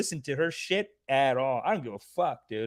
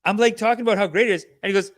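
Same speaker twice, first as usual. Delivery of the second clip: ordinary talk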